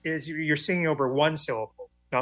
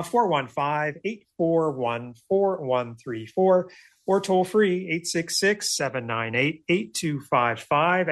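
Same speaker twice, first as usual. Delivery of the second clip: number read-out; slower than usual. Shown as a demonstration. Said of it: "phone number read".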